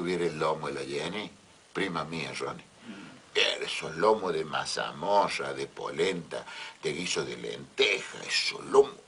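leering. Acted. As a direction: savour each word like a dirty old man. Leering aside about Jenny's body.

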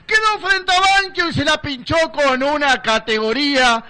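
high, loud ecstatic goal call; breakneck goal commentary. Real, not acted.

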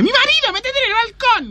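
mock woman's voice. Wife warning her lover that the husband is home, high falsetto. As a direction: squeak it out like a panicked wife.

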